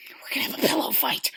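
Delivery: hushed mock-secret announcement; conspiratorial whisper. Host announcing the pillow fight date, conspiratorial whisper.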